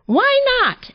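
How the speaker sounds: high, loud rabbit voice; rabbit's question. A narrator's reading. Rabbit questioning grumpy tortoise, high and loud. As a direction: pipe up loudly, way up high. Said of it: "asked Rabbit".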